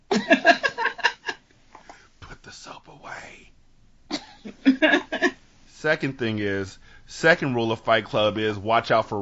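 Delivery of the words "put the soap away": slow, hushed whisper; mock menacing whisper. Joking with co-hosts about Fight Club, slow whisper. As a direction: whisper it slowly, drawing out each word.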